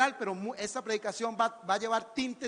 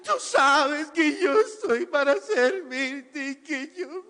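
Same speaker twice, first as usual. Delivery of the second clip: slow, higher mock sobbing prayer; mock crying prayer; 3 semitones higher, much slower than usual. Preacher mocking a mother praying over her children, mock sobbing. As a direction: wail a self-pitying prayer.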